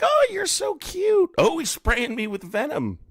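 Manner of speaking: squeaky high mock baby talk; mock cutesy voice. Mocking chat viewers as clueless, high cooing voice. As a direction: coo it like a dumb doting fan.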